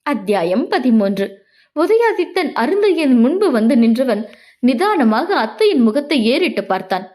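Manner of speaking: usual speaking voice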